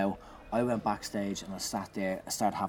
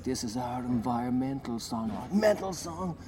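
mock weird shouting; 3 semitones higher than usual. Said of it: "me shouting weird stuff".

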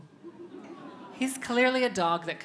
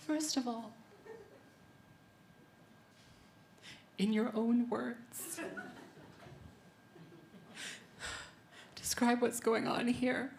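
high, halting mock-crying voice; hammed-up struggle to speak through tears, long gaps; acting tearful; 6 semitones higher, 7 dB quieter than usual. Comedian retelling her questions to partner while crying, mock tearful. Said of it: "And so I'm crying naked on top of this man".

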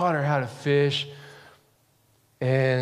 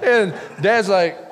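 usual speaking voice

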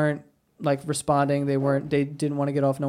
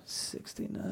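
whispered; 10 semitones lower, 19 dB quieter, slower than usual. Real, not acted. Repeats the age under breath.

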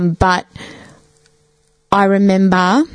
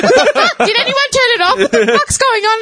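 brother's shocked yell. Retold or imitated. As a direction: squeal it like a startled brother. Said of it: "He's like".

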